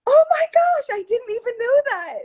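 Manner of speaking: high, loud delighted surprise; genuinely thrilled at the news; real excitement. Real, not acted. Guest hearing a friend joins the show, high loud delight.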